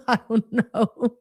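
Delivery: laughing through the words. Real, not acted. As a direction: say it with a real laugh. Laughing at a super chat question.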